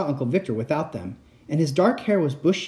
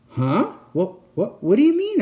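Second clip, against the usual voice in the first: choked out; 8 semitones higher, 5 dB louder than usual. Acted. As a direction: stammer rising high and loud. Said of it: "I choked out".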